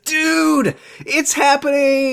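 very high, drawn-out squeal; excited. Retold or imitated. Host texting co-host about the scene, excited squeal. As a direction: squeal it slowly like breathless big news. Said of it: "so excited".

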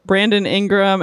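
usual speaking voice

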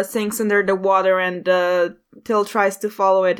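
ordinary talk, nothing marked

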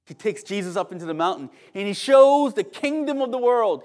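ordinary delivery

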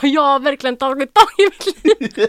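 high, loud, laughing outburst; excited boasting. Retold or imitated. Daughter proudly telling dad, excited outburst. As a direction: burst out with it, nearly squealing with laughter.

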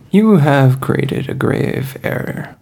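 slow, loud ominous threat; slow, theatrical menace; menacing villain. Acted. Hunter threatening the party over comms, slow and ominous.

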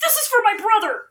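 very high mock-crying accusation; hammed-up tearful cry. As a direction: wail it like an overwrought avenging kid. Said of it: "he's crying and he goes".

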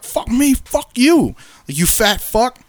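high-pitched imitation of an angry man; mock angry comeback. Dog owner cursing back at him, mock angry.

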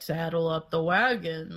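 a hint of a Southern cowboy; Southern cowboy accent. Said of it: "talking in a Southern accent like a cowboy".

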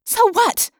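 high-pitched defiant retort; shot back. A narrator's reading. Rachel answering Jake's scolding, defiant retort. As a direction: throw it straight back at him. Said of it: "I shot back".

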